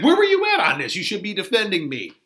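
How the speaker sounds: high, scolding squawk at the start; mock offended nurse; indignant nurse. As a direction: scold the servant for not helping. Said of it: "And it's like".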